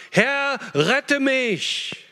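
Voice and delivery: drawn-out desperate cry, pitched high; Peter's slow, high plea for rescue; cry for help. A narrator's reading. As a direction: cry out like a man sinking in the waves. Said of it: "schrie er und sprach".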